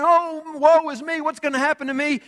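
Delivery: high, self-pitying mock lament; mock pouting. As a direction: whine it like a sulker feeling sorry for himself. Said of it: "we're pouting and we're saying".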